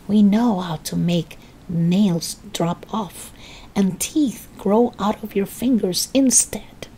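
throaty witch whisper; old woman whispering. Acted. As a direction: breathe it like a witch. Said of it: "whispering in a throaty sort of voice".

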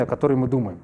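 ordinary talk

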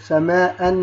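"سماء" ending in -an after the hamza; "سماء" pronounced samaa'an with a held final n; tanween fath. Shown as a demonstration. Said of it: "نضع الفتحتين على همزة".

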